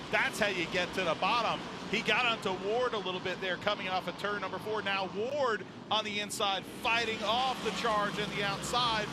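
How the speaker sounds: loud, driving race call; live play-by-play. Acted. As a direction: push the action, keep it rolling.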